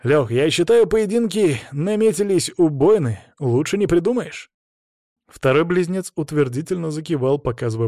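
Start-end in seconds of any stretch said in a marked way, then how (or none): 0.0-4.5 high, keen enthusiasm; raised, punchy relish; excited. A narrator's reading. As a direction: share your delight in the match-ups. Host delighted with the pairings.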